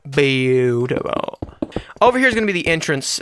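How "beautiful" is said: drawn-out. Acted. Drawn out in a put-on voice.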